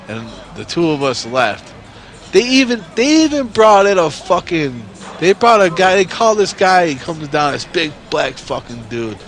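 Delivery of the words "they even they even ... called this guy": excited. Real, not acted. Building up the story.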